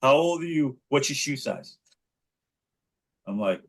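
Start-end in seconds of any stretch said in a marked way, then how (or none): 0.0-1.8 put-on high, childlike nosy questions; curious kid. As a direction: play a nosy third grader. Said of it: "He said".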